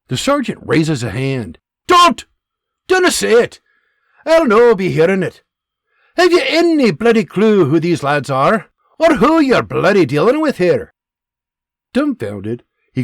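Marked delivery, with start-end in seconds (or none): 0.1-10.9 loud, high Scottish dressing-down; Scottish sergeant tirade. Acted. Scottish sergeant berating his angry private. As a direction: tear strips off him, Scottish.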